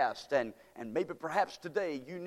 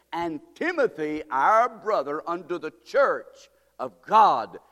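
loud, slow, ringing scripture reading; preaching scripture; 2 semitones higher, 5 dB louder than usual. Acted. Preacher reading Corinthians aloud, slow loud proclamation. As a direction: proclaim every phrase to the hall.

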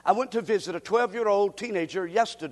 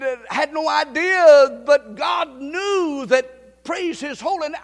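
loud, rising preacher's testimony; fervent preacher testifying; preaching at full force; 5 semitones higher, 7 dB louder than usual. Acted. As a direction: build it up like a pulpit testimony.

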